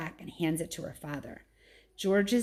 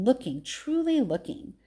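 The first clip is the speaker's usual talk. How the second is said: ordinary delivery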